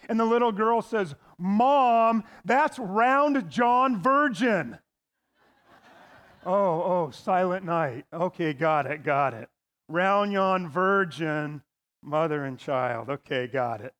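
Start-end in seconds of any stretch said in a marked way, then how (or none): 1.4-4.8 put-on drawn-out matter-of-fact child; slow, spell out the punchline. Little girl tells mom the obvious answer, slowly. 6.4-13.9 lower, dopey slow got it; mock dopey realization. Pastor plays the dim listener getting the pun, mock dopey.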